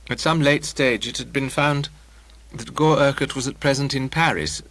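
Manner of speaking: usual speaking voice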